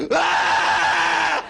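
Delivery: put-on high loud childbirth scream; mock labour scream. Comic acting out his sister's childbirth, loud scream. Natural childbirth breathing exercises.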